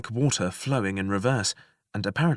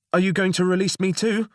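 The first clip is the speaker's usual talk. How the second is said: high, fast call upward; goblin calling up; 9 semitones higher, 5 dB louder, faster than usual. Acted. Goblin calling up to the jailer, high and quick. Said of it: "one of them called up".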